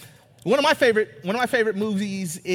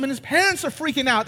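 usual speaking voice

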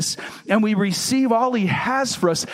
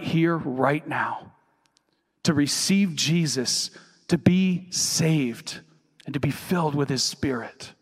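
lower, hushed pastoral appeal with long pauses; subdued, unhurried invitation; gentle altar call; 4 semitones lower than usual. Real, not acted. Altar call after the preaching.

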